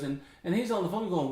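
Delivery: usual speaking voice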